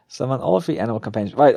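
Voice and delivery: normal talking voice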